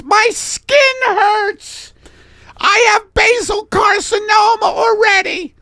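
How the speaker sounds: very high, loud falsetto cry; anguished wailing. Acted. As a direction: wail in hammed-up pain. Millie wails about her skin.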